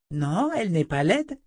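put-on high child's voice; little girl. A narrator's reading. Girl defending the woman to the man, childlike.

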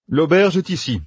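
planning aloud with brisk confidence; a hint of a young general laying out plans; Matt's voice. A narrator's reading. Narrator voices Matt.

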